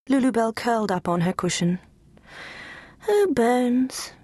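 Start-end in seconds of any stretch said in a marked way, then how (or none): 3.1-4.1 sighing lament. Acted. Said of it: "she sighed".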